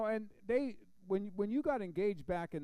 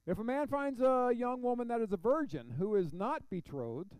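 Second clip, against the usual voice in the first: loud, raised-pitch Bible reading; scripture reading; 8 semitones higher, 7 dB louder than usual. Acted. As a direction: read the verse out to the room, pitched up. Reads scripture aloud to congregation.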